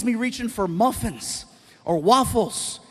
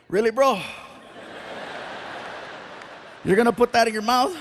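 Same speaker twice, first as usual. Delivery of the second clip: mock scold; 3 semitones higher than usual. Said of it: "I gotta look at him and say".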